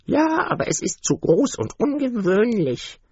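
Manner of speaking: high, spiteful duck voice; biting duck. A narrator's reading. The biting duck answers the mother, high and spiteful. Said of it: "erwiderte die beißende Ente".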